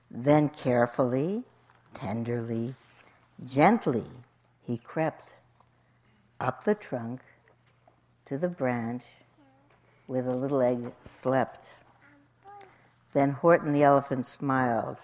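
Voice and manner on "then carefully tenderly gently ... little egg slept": soft, hushed storytelling with pauses; low, gentle, halting narration; careful and tender. A narrator's reading. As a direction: tiptoe gently through each phrase. Elephant creeps gently to sleeping egg.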